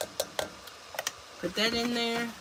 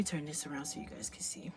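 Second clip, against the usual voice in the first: whispering; 5 semitones lower, 14 dB quieter than usual. Real, not acted. Whispered aside while filming.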